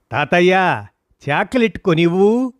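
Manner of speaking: put-on child calling out loudly, high. A narrator's reading. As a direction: shout it into grandpa's ear. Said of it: "అని గట్టిగా అరిచాడు".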